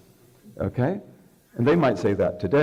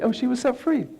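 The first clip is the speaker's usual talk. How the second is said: joyful exclamation; 13 semitones higher than usual. Retold or imitated.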